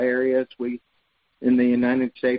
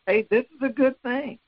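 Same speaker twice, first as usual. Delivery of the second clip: much higher-pitched, upbeat nudge; friendly reminder; 10 semitones higher than usual. Acted. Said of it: "he's reminding us".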